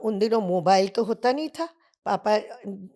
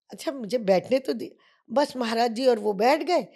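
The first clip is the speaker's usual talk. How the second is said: ordinary talk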